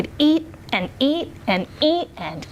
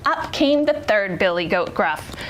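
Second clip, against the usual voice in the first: usual speaking voice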